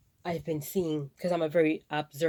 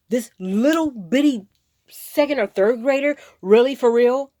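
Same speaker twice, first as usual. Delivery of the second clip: loud, higher, slow indignant outburst; real outrage; 6 semitones higher, 11 dB louder than usual. Real, not acted. Venting to viewers about the hungry little kid, real outrage.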